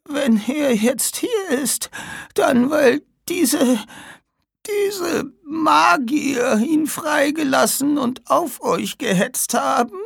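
high, slow, breaking whisper then cry; dying old woman. Acted. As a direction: struggle for breath to warn them. (sterbende alte Frau, stockend)

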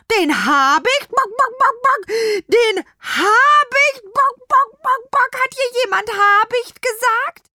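very high, loud squawking alarm; panicking hens. A narrator's reading. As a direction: shriek the dreaded word between frantic clucks. Hens panic on hearing hawk mentioned.

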